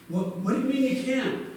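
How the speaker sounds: a touch of incredulous hero; surprised Jonathan. Retold or imitated. Jonathan baffled by refusal.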